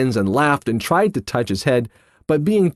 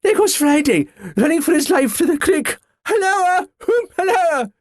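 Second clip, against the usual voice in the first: much higher, excited calling out; whooping cheer; 14 semitones higher than usual. A narrator's reading. Scrooge cheering Friday on, calling out high. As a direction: hallo after a running figure. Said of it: "voice between laughing and crying".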